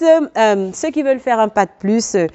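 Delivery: ordinary talk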